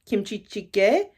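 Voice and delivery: careful, tentative kimchi jjigae; Korean dish name. Real, not acted. Naming Megan's Korean dish, careful attempt. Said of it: "if I say this wrong, please correct me".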